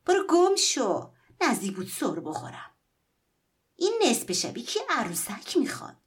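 loud, high shout of irritation; woman nearly slips and yells at the boy; angry yell. Acted. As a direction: snap at the boy to clear off. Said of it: "زنک داد زد".

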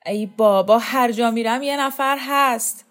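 loud, exasperated complaint; acted irritated outburst; angry. A narrator's reading. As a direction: burst out in bitter annoyance, pitch climbing.

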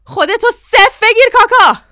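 high, very loud urgent yell; shouting. A narrator's reading. Father calls to Kaka as danger hits, shouting. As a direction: holler it up to the roof. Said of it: "بابا جانم داد زد".

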